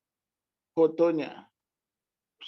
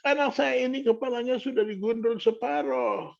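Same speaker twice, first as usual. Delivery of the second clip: high, mildly tearful mother's voice; imitating distressed mother; 9 semitones higher, 4 dB louder than usual. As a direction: plead anxiously, pitched well up. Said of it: "hanya isak tangis yang saya dengar".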